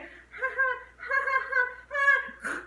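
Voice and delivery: high, slow eerie cackle; possessed woman laughing at the nurse; mock creepy laugh. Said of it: "she starts laughing and she's like".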